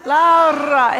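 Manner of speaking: "Laura" with a trilled, rolled R, loud; loud "Laura" with a Spanish-style trilled R. Shown as a demonstration. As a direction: roll the R in "Laura" proudly. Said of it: "it has to roll the R".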